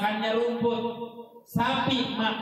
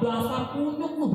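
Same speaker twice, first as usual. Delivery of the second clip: mock angry husband; 7 semitones higher than usual. Husband insults wife in anger.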